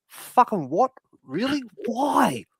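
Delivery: high, punchy mock disbelief; retold incredulity. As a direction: replay your baffled questions, pitched well up. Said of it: "and I was like".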